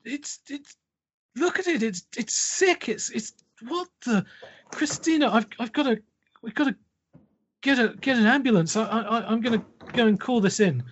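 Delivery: a deputy losing his nerve, rushing to call it in; high, hurried, horrified babble; panicked deputy. Acted. Deputy panics over the baby.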